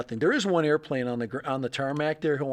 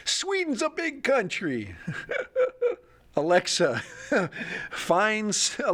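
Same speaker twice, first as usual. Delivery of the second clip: laughing; 6 semitones higher, slower than usual. Real, not acted. Laughs at failed search.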